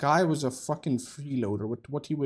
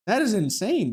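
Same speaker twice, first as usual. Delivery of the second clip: excited; 8 semitones higher, 6 dB louder than usual. Real, not acted. Reacting to huge damage.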